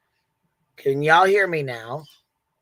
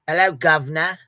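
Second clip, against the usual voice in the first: mock British accent; 5 dB louder than usual.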